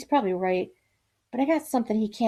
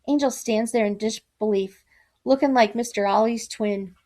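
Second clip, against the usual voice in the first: ordinary delivery, nothing marked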